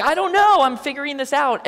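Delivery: high, loud flustered "I don't know"; a nervous new leader admitting he's lost; flustered panic. Retold or imitated. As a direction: blurt it out in a rush of panic. Panicked first time leading.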